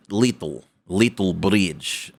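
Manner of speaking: slow, drawn-out mock Russian; lightly voiced Russian; mock Russian accent. Shown as a demonstration. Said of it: "I am Russian".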